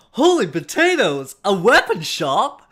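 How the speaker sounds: loud high excited cry. Retold or imitated. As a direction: shout it with wide-eyed glee. Quoting an excited game line.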